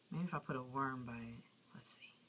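soft whisper. Real, not acted. Putting a worm by the lizard, a soft whisper. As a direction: whisper so the lizard stays calm.

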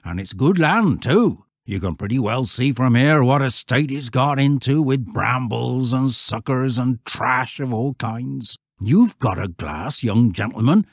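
countryman laying down the facts; earnest old shepherd. Acted.

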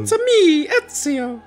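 very high cartoon Italian plumber; squeaky Mario catchphrase. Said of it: "Don't make that joke".

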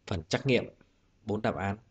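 ordinary talk

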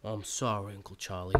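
low, soft, contrite; subdued apology. Acted. Nephew apologises to his uncle, subdued. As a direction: apologise quietly, ashamed.

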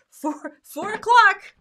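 a hammed-up panicked thought; mock alarm. Crafter mocking her own panic at the clock, mock alarm. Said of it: "looking at the clock and thinking".

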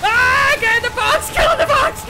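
high, loud frantic shouting; panicked yelling. Real, not acted. Player screaming at her companion to climb on, frantic high yell. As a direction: scream orders in real panic.

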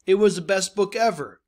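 bright excited read-aloud; enthusiastic quote. Shown as a demonstration. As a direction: read it like a kid who loved the book. Teacher reads example quote enthusiastically.

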